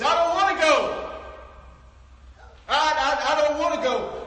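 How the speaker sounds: loud, high desperate wail; acted crying plea. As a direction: cry out like a condemned man pleading. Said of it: "he begins to cry".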